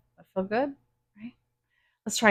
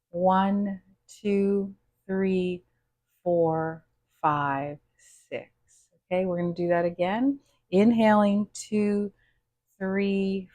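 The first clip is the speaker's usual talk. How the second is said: guided breathing; slower than usual. Acted. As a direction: count the breaths gently and slowly. Leads a counted breathing exercise.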